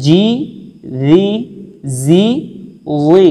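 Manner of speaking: জি heavy and রি light, alternating; minimal-pair drill; contrasted letters. Shown as a demonstration. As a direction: make জি and রি sound clearly different. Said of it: "আরেকবার শুনলে বুঝতে পারবেন".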